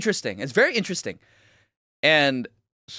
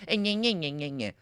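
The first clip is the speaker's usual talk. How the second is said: mocking nyeh; 3 semitones lower, 9 dB quieter than usual. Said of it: "mocking heckler".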